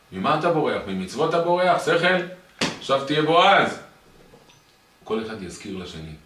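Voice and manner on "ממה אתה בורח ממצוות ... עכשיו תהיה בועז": loud, high exhortation; raised-voice rebuke, pushing them to act; acted scolding. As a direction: scold them hard for running away. Acted scolding of someone fleeing.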